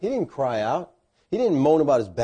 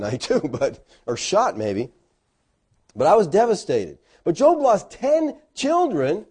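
usual speaking voice

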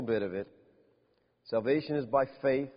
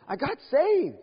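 high, bright-eyed burst of joy; excited young woman; 10 semitones higher, 4 dB louder, slower than usual. Retold or imitated. A young woman tells the preacher she's saved, high and thrilled. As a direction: let her news burst out high.

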